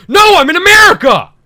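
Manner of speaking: high, loud outraged yell; indignant shout. Retold or imitated. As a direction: bellow it, offended. Shouted reply to Spanish clerk.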